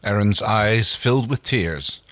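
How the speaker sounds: plain reading voice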